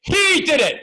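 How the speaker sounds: a hint of high dramatic accuser; courtroom accusation. Picturing a courtroom drama, mock accusing cry. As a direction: point the finger like a TV lawyer. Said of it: "American courtroom drama".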